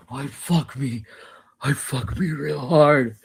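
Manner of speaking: lightly voiced creepy killer; half-hearted mock creep voice; imitating Buffalo Bill. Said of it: "He's freaking Buffalo Bill".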